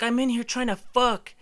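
high-pitched exasperated holler; imitating a frustrated shout from hiding; retold yell. Guy in a slide to intruders, exasperated yelling. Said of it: "this slide is yelling at me".

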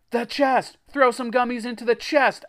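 high, loud urgent command. A narrator's reading. Narrator directs Jack and Greg, commanding. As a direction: shout orders mid-emergency. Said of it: "I commanded".

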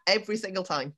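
ordinary talk, nothing marked

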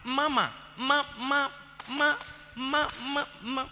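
put-on high stuttering child voice, calling for daddy; stammering child. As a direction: stutter and stumble like a small child. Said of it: "a stammerer".

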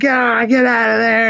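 strained high retold scream; mock scream. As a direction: imitate someone screaming to get out. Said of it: "he's screaming and screaming".

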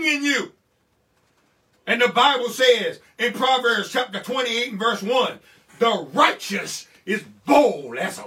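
loud, high, rising sermon cadence; preaching at full force. Acted. Pastor rousing the church, preaching loud and high. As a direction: thunder each question at the congregation.

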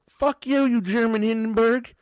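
mild mock yell of a schoolkid; retold yell. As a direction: taunt it like a schoolyard insult. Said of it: "And he said".

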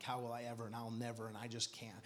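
low, soft self-doubting murmur; negative inner thoughts. Acted. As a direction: voice creeping doubts under the breath. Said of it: "the negative thought starts coming".

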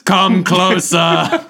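slow looming command, laughing; ominous god voice. Pretend. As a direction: draw it out like a menacing deity. Zeus on his throne.

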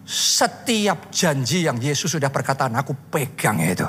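low, solemn prayer; earnest low-pitched pledge to God; hushed devotion. Retold or imitated. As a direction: let it sink into a near whisper at the end. Personal prayer holding to God's promise.

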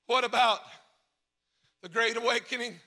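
ordinary talk, nothing marked

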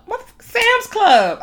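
can you believe it; venting about Sam's Club, outraged; outraged disbelief. Real, not acted.

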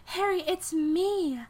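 high, indignant Sally reminding him; high, indignant voice reminding Harry of the past. Acted.

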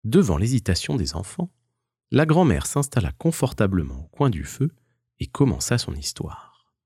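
plain reading voice

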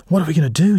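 high, breathless panicked question; panting. Acted. Edie fleeing up the stairs, panting and loud. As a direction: gasp it out after running up the stairs. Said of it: "panting as they reached the room".

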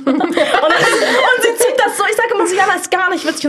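giggling hard through the words; can barely get it out for laughing. Real, not acted. Laughing while telling friends about Jana.

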